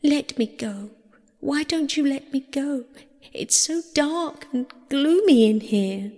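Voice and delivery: high-pitched, mildly tearful pleading; sobbing plea. A narrator's reading. Persephone begging her captor to release her, sobbing. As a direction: plead miserably in a lifted girlish storybook voice. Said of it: "sobbed Persephone".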